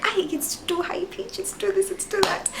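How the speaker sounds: very high-pitched fretting over her own voice; mock your old insecure self, high and squeaky; self-mocking complaint. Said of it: "I used to be like".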